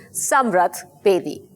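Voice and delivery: ordinary talk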